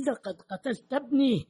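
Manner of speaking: high, anguished outburst; fierce accusation. A narrator's reading. Father accuses his son's killer at gunpoint, fierce. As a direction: burst out at the killer, pitch leaping up. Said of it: "وبدت نبرته شرسة جدًا".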